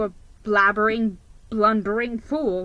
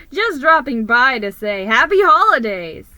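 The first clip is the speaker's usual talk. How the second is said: loud cheerful yell; 2 semitones higher, 8 dB louder than usual. A narrator's reading. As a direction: holler a holiday greeting. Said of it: "the rodent yelled".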